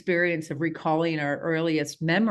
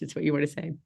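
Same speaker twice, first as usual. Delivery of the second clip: ordinary talk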